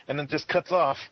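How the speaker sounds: normal talking voice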